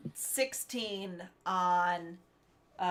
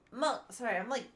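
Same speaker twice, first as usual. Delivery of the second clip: talking through burps; burping; 7 dB quieter, faster than usual. Real, not acted. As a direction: speak between burps. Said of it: "I'm burping so much".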